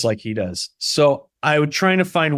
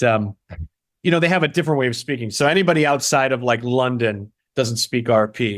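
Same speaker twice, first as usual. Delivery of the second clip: ordinary delivery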